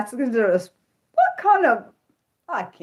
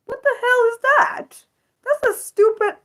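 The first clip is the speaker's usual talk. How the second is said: outraged disbelief. Real, not acted. Hearing what power slap is, outraged disbelief. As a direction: squeal it in disgusted disbelief.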